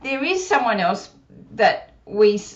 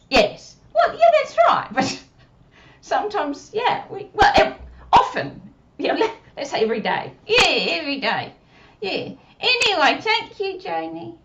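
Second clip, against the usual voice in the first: put-on puppet child voice; 7 semitones higher, 3 dB louder than usual. Puppet Susie speaking.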